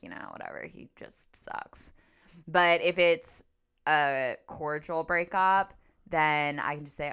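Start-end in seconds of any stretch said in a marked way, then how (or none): 0.0-1.9 a conspiratorial whisper; whispered aside. Retold or imitated. Covering up the real reason.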